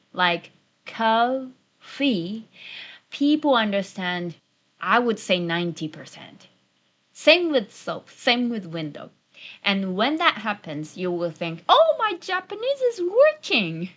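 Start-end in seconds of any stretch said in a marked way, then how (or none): 0.9-2.5 very slow, syllables held apart; slow Japanese rhythm. Shown as a demonstration. Teacher showing learners the rhythm, very slow Japanese-style syllables. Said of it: "coffee slowly with the Japanese rhythm". 11.7-13.9 high-pitched, thrilled discovery; delighted realisation. Acted. Learner thinking the Japanese works, high delighted surprise.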